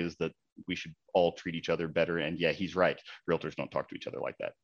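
ordinary delivery

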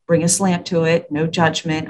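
normal talking voice

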